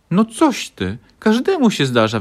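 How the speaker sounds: high, loud encouraging cry; cheerful exclamation. A narrator's reading. Badger Leoś reassures Franio, loud and high. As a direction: cheer him up with gusto. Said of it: "wykrzyknął borsuk Leoś".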